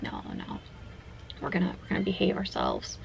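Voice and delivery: soft, near-whispered resolve; calm yourself down under your breath; hushed self-restraint. Retold or imitated. Player talking herself out of evolving, hushed restraint.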